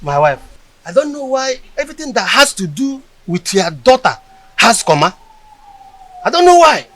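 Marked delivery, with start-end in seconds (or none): 5.0-6.9 raised, drawn-out complaint; louder and higher, stretched in frustration; exasperated outburst. Acted. A worried husband complains to his wife about their daughter, exasperated outburst.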